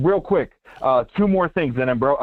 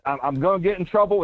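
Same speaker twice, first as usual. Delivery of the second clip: ordinary talk, nothing marked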